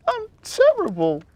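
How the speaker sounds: high little elf voice with a touch of tears; mild sobbing elf. A narrator's reading. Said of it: "she sobbed".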